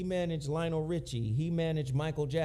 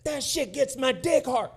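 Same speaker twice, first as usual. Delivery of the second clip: higher, louder retelling of the boss's line; excited boss quote; 7 semitones higher, 5 dB louder than usual. Retold or imitated. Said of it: "He was like".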